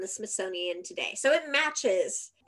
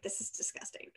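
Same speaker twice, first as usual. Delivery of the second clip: whispered disgust; 3 semitones lower, 13 dB quieter than usual. Pretend. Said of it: "you're gonna be like".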